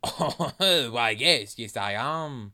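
put-on French-accented banana, with a chuckle. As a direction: play it in a hammy French accent. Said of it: "what's a French character?".

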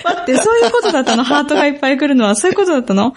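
high, fast surprised question; surprised realisation. Real, not acted. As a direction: suddenly get it, amazed. Realises why hearts come.

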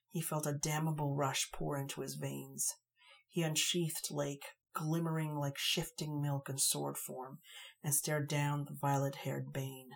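ordinary delivery

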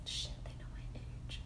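hushed panicked whisper. Real, not acted. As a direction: breathe it out, no voice. Panicked aside about revealing age.

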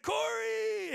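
high, loud imitation of a stage shout; calling out. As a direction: holler a name across a crowd. Singer calls him out from stage.

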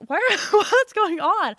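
high, loud, drawn-out amused disbelief; retold bewilderment. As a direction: act out baffled protest, breaking into a laugh. Retold bafflement at husband blaming dog.

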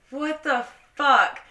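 loud, drawn-out disbelief; retelling an incredulous reaction at volume; retold exasperation. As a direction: stretch each word out, loud and high. Retold shocked reaction to the news.

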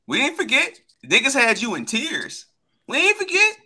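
mocking, pitched high; teasing a rival fan in a high voice. Real, not acted. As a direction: needle him with a high sing-song.

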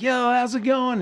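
high-pitched retold call to an audience; calling to a crowd. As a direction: greet a packed theater from the stage. Greeting a crowd on stage.

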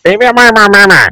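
high whiny mock of a nagging woman; mocking whiny girlfriend. Friend mocking a negative woman, high whiny voice. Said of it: "this negative bitch on some".

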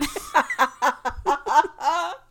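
high, loud laughing fit; helpless laughter. Real, not acted. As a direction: burst out laughing and keep going. Cracks up at the notes.

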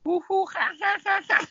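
high hooting ha ha ha; monkey call. Pretend. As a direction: hoot like a monkey. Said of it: "Is that a monkey?".

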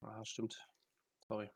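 ordinary talk

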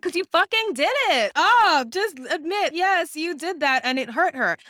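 high, loud exasperated outburst; furious mock shout at the screen; outraged yell. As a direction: yell it at him, fed up. Calling Shane out, outraged.

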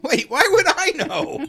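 high laughing protest. Real, not acted. Teased about nuts.

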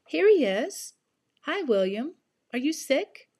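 put-on chirpy excited teen friend; cheerful young girl. [name] voiced.

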